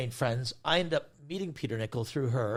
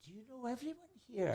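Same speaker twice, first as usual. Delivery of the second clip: very soft, high-pitched aside; imitated hushed amazement; 6 semitones higher, 14 dB quieter than usual. Mum privately marvelling at her son, imitated hushed amazement. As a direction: ask it high and under your breath.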